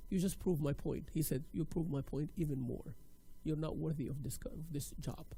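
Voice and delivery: soft, low retold verdict; quiet rejection. Interviewer turning down crying candidate, quiet rejection.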